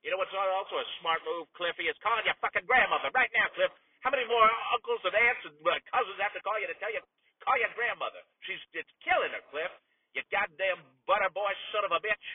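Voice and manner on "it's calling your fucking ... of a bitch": angry rant. Acted. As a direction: tear into him for not calling. Ranting at nephew mid-broadcast.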